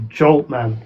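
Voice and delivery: normal talking voice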